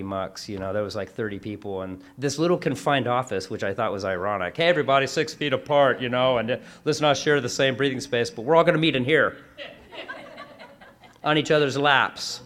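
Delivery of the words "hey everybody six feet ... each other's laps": louder, hammed-up call to the room, laughing at the end; mock announcement. Mocking the crowded office meeting's call to the room, loud and laughing. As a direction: send up the boss's safety speech with a laugh breaking in.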